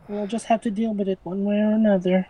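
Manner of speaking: normal talking voice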